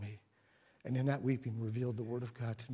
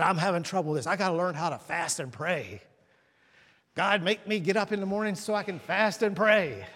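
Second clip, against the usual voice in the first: louder and higher, a man begging God for help; Brother Buford pleading; 5 semitones higher, 6 dB louder than usual. Retold or imitated. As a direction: plead to be woken up to pray. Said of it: "He said".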